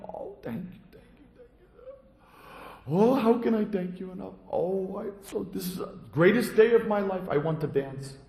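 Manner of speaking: high, gushing, near tears; overjoyed thanks. Acted. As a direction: thank him from the heart, overwhelmed. Monk thanking the boy tearfully.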